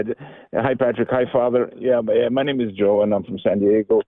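ordinary talk, nothing marked

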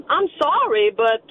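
ordinary talk

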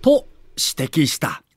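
ordinary delivery

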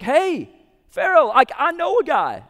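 eager call out. Retold or imitated. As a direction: call out to grab Pharaoh's attention. Cupbearer calls out to Pharaoh.

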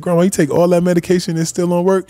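high, loud disbelief; incredulous grandson asking his grandma; quoting himself. Retold or imitated. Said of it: "So I said".

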